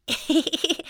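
small, quiet giggle; light little laugh. Acted. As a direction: giggle softly mid-story. Young mouse telling the story.

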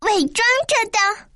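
high-pitched startled outburst; high indignant exclamation; exclaiming cry. A narrator's reading. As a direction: cry out in disbelief. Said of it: "陶乐斯喊着".

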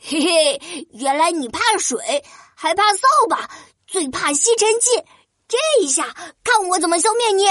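high, triumphant taunt; gloating. Acted. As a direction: taunt the beaten monster. Prince gloats at the monster.

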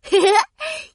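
short bright giggle, rising; light giggle. Acted. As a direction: giggle sweetly at the offer. Laughs at frog's offer.

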